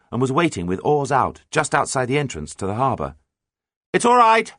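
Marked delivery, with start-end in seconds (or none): 3.9-4.5 high, loud all-clear; shouted. A narrator's reading. Captain signals the mate waiting outside harbour, shouting. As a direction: shout it across to the other boat. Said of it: "shouted John".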